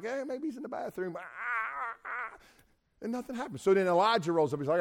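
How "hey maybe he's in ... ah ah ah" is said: much higher, teasing and softer; mocking taunt. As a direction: poke fun at them lightly. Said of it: "Elijah's like".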